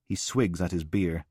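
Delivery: ordinary delivery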